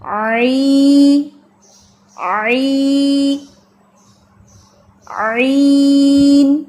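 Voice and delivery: very slow stretched آئین; long drawn vowel. Shown as a demonstration. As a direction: stretch آئی slowly, rising in force. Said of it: "اس کو ہم پھر کھینچتے ہیں".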